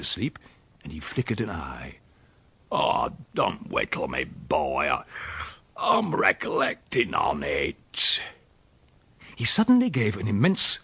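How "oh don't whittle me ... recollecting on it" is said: low, slow rustic grumble; drowsy old countryman. Acted. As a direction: grumble as if nudged from a doze. Dozing uncle nudged by the boy.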